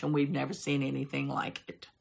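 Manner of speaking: ordinary delivery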